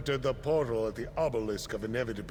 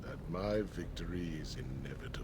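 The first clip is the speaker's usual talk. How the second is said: menacing whisper; 6 semitones lower, 7 dB quieter, faster than usual. Acted. Villain whispering his triumph to the fallen hero. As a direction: whisper the gloat, soft and cold.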